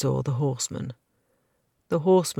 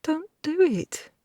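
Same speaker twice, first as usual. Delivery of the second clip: sobbing; 13 semitones higher, slower than usual. A narrator's reading. Girl begs her attacker.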